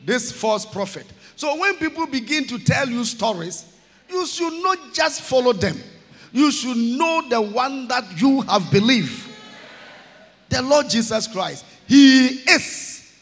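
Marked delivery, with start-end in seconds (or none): 1.4-13.1 rising, louder, shouted sermon exhortation; preaching at full force. Acted. Preacher urging the church to know the Lord, full-force preaching. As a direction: drive it home to the crowd.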